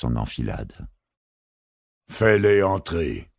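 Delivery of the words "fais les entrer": slow, high booming command; thundering voice. Acted. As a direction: boom it out slowly like thunder. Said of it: "tonna une voix grave".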